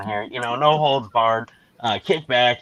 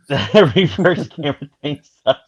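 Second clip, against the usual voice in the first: laughing; 3 semitones higher, 4 dB louder than usual. Real, not acted. Repeating a guest's complaint, laughing.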